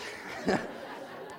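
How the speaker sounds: airy, quiet laugh; soft laugh. Real, not acted. Narrator laughing while telling mom dad's hurt, soft laugh. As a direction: laugh under your breath.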